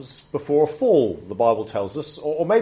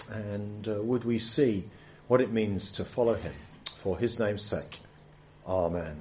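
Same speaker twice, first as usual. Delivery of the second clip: soft, hushed prayer voice; 2 semitones lower, 4 dB quieter than usual. Acted. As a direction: pray quietly on behalf of everyone. Leads the congregation in prayer.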